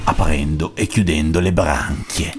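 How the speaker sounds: slow, ominous story ending; eerie, drawn-out closing line; creepypasta voice. A narrator's reading. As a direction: draw out the last line like a creepypasta. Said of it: "Con la voce creepypasta".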